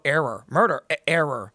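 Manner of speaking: mock robotic glitch; malfunctioning drone. Police drone answering a baffled driver, robotic. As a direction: stutter it like a machine reporting an error. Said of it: "And it's gonna go".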